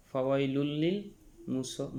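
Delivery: ordinary talk, nothing marked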